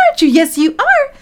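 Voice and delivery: high, loud gushing at a dog; excited pet talk. Acted. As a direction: gush excitedly like hyping up your dog. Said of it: "whenever I am acting excited".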